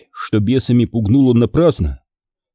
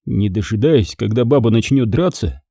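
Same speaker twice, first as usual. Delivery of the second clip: usual speaking voice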